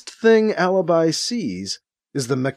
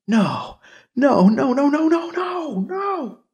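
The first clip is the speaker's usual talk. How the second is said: building sobbing cry, rising higher; anguished cry; 11 semitones higher than usual. A narrator's reading. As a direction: wail it out, climbing into sobs. Character sees body, cries out.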